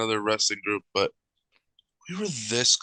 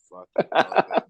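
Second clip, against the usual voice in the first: normal talking voice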